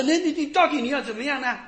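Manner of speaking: high, hurried crowd protest; imitated clamoring. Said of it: "人家就嚷嚷说".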